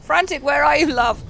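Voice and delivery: loud, high call for the pet. Real, not acted. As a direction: call across the map anxiously. Calling out for lost pet.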